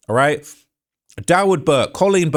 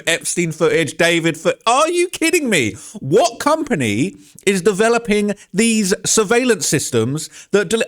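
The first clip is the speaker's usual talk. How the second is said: pissed off; 5 semitones higher than usual. Real, not acted. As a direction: rant at the absurd excuse, voice raised and high. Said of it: "can you see how pissed off I am?".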